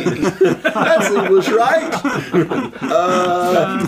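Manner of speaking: laughing, slow and high; amused disbelief. Real, not acted. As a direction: laugh through a teasing question. Teasing the wrong accent, laughing.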